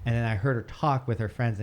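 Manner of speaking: ordinary talk, nothing marked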